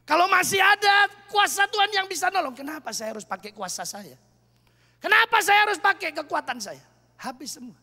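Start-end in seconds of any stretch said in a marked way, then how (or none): 2.6-4.2 softer, lower rhetorical question; hushed self-reproach. Acted. 5.0-6.8 louder, higher repeat of the question; preacher's rising challenge. Acted.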